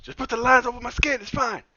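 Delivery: high-pitched mock insisting; difficult actor act. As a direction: protest like a stubborn star on set.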